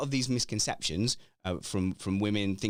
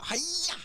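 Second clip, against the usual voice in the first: high-pitched "hi-ya!"; comic karate chop yell; mock karate cry. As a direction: let out a playful, drawn-out martial-arts cry. Mimics combat move over Zoom.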